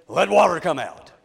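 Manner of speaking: loud, angry command. Shown as a demonstration. Preacher showing Moses losing his temper at the rock, angrily. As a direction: order it out forcefully, as if temper is lost. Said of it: "could have said it angrily".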